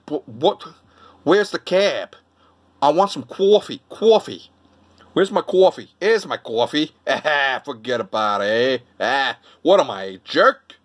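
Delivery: thick Brooklyn, "coffee" as "cwawfee"; Brooklyn accent. Shown as a demonstration. Demonstrating their first accent, hammed-up Brooklyn. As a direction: put your words in your cheeks, Brooklyn style. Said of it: "So Brooklyn accent is like".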